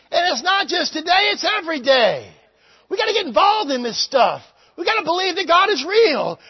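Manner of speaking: loud, high fervent preaching shout; full-force preaching. Acted. Preacher shouting to his congregation at full force. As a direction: preach it out at the top of the voice.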